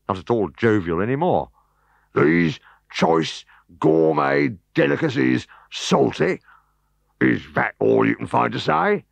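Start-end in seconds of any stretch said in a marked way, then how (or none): 2.1-9.0 indignant. Acted. Offended host protests.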